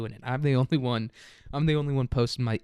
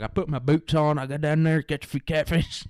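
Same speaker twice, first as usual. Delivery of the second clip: higher-pitched put-on country accent; 3 semitones higher than usual. Imitating himself to a classmate, sudden country accent. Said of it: "I just started talking like that".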